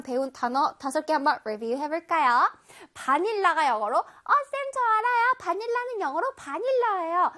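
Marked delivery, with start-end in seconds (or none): 4.0-6.3 high, eager pupil piping up. Acted. Voices an eager pupil answering. 6.4-7.3 바닐라 in plain Korean syllables, ba-nil-la; Korean pronunciation. Acted. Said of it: "맞긴 하지만 제대로 발음하면".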